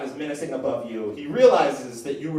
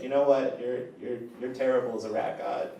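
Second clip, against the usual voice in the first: tender prayer; 6 semitones lower, 4 dB quieter than usual. Acted. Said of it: "you're just like".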